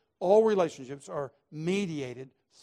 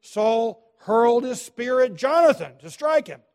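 loud, slow, higher proclamation; dramatic loud preaching; 7 semitones higher, 11 dB louder than usual. Acted. Preacher dramatizing Saul's attack for the congregation, booming. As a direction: hurl the words like the spear.

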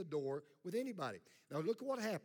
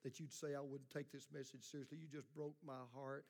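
a soft, hammed-up wounded churchgoer, faster at the end; mock hurt congregant; 3 semitones lower, 9 dB quieter than usual. Pastor mimics a wounded listener, soft mock hurt. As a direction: play the hurt listener, lightly mocking. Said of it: "if your heart's tender ... and you say".

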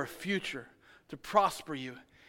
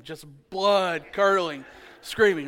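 slow, louder retelling of the screaming; drawn-out emphasis; 5 dB louder, slower than usual. Shown as a demonstration. Preacher stressing how loud his daughter screamed. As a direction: stretch out every word, louder. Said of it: "caught me last week after Bedlam".

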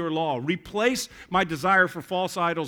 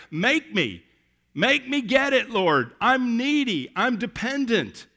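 loud pleading cry to God; needy plea; 7 semitones higher, 7 dB louder, slower than usual. Acted. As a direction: beg hard, almost shouting. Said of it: "But that's like".

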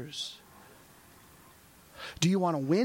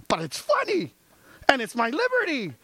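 put-on much higher, childish whining; whiny protest; 8 semitones higher than usual. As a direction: plead like a sulky kid. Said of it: "pastor voicing himself arguing".

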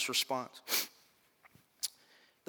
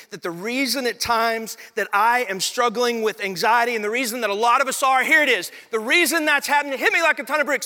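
rising, louder pulpit build; preaching at full force; 8 semitones higher, 5 dB louder than usual. Acted. Pastor urging his congregation, preaching at full force. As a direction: drive it home like a revival preacher.

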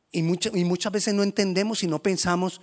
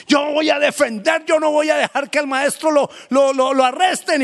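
Peter's defiant shout; 12 semitones higher, 11 dB louder than usual. Acted. Peter defending Jesus at the arrest, loud high defiant shout. Peter drawing his sword.

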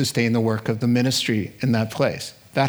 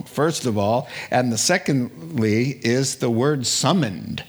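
ordinary talk

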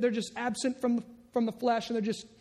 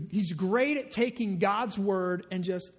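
ordinary delivery

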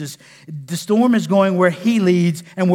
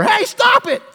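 very high, urgent yell; mock shouted command; 4 dB louder, slower than usual. Man ordering a spewing Doctor Pepper, high mock shout. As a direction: shriek an order at a fizzing soda, hammed-up. Acts out commanding a fizzing soda.

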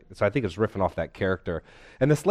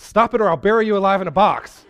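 much higher, louder comic outburst; frustrated mock yell; 12 semitones higher, 11 dB louder than usual. Therapist finally blowing up at the patient, mock yell. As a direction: explode at her in hammed-up frustration. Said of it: "he's getting really frustrated".

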